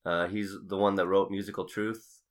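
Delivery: usual speaking voice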